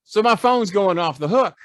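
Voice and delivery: normal talking voice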